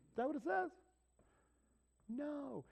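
high disbelieving question; mock-shocked preacher; incredulous challenge. Real, not acted. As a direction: scoff at the misquote. Rhetorical challenge.

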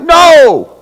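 Dave's refusal. Acted. Dave blocking the preacher at the door, loud shout. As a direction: bark a blocking No at him. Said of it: "And Dave's like".

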